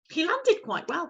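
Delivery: ordinary delivery, nothing marked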